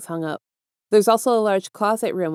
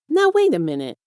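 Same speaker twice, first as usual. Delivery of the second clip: higher-pitched, confused protest; startled objection; 8 semitones higher than usual. A narrator's reading. Boy objects to villain adopting him, startled. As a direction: break in with a high, startled objection.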